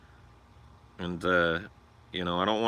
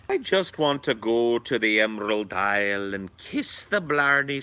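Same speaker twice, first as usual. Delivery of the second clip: mock Irish brogue, higher and slow; hammed-up Irish accent; 6 semitones higher, slower than usual. Streamer dreaming of Ireland, hammed-up Irish accent. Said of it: "that accent is really the hardest".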